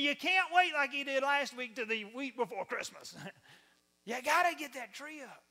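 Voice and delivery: high, loud, insistent nag; nagging reminder. Acted. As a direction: pester them in a hammed-up high voice. Acting out nagging holiday reminders.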